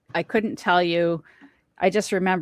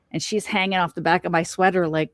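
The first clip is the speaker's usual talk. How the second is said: usual speaking voice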